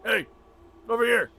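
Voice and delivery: high, loud hollering across the street; calling out. Acted. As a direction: shout them over like a hawking half-orc butcher. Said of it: "he starts to call out to you".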